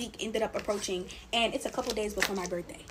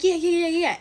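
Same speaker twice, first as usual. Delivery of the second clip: higher, louder string of yeahs; quick reassuring yeahs; 8 semitones higher, 7 dB louder than usual. Retold or imitated. Friends answering her monthly check-in, quick eager agreement. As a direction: imitate friends hurriedly agreeing.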